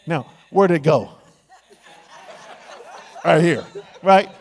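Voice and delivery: loud laughing magician tease; a magician teasing the audience with a laugh. Acted. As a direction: laugh through the reveal.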